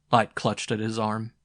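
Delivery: ordinary delivery, nothing marked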